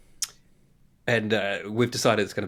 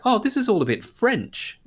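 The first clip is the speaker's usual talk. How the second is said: mock child voice; 11 semitones higher than usual. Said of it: "she's like".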